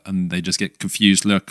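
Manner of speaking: normal talking voice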